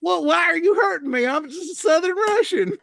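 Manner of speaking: high, put-on Southern-accented voice; mock Southern Russian. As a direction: plead as a hurt Southern Russian, hammed up. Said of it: "I thought they speak in South Russia".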